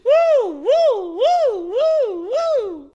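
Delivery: loud, high repeated whoops; excited whooping. Real, not acted. Host cheering big man talk, excited whoops. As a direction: whoop long and high, over and over.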